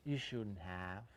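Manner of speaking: "Oh, you shouldn't have" drawn out slowly, rising on "shouldn't"; extra polite, slow. Shown as a demonstration. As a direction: stretch it out as if touched by a gift. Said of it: "If you want to be even more polite, say".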